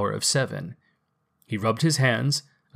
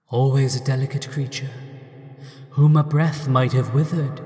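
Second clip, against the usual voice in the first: ghost voice; 2 semitones higher than usual. Acted. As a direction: speak as a gentle ghost. Ghost character voice.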